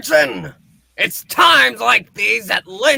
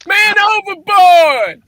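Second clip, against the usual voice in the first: shouting; 7 semitones higher, 5 dB louder, slower than usual. Acted. Calling out man overboard.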